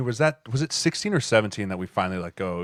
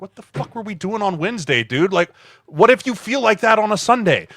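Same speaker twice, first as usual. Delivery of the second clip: higher, louder exasperated retort; mock angry rant; 9 semitones higher, 5 dB louder than usual. Teammate scolding the kicker after a miss, higher exasperated rant. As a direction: let him have it, higher and louder.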